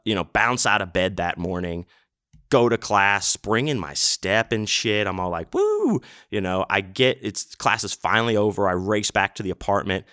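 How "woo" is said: a sudden very high whoop of joy; excited whoop. Retold or imitated. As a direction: let out a gleeful cheer.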